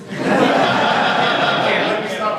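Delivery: long agonized dying scream; death scream. Shown as a demonstration. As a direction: scream like a warrior dying in battle. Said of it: "It gets a little loud".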